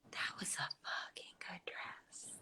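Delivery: slow reverent whisper; hushed awe. Retold or imitated. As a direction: whisper it slowly, savoring each word. Reacting to an old photo.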